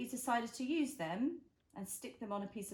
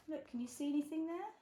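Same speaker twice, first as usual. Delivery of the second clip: usual speaking voice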